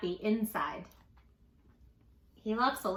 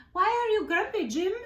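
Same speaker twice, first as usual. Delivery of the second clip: much higher, curious; high lemur voice; 8 semitones higher, 4 dB louder than usual. Acted. Lemur asking Jim why he is grumpy, high voice. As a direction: ask it brightly.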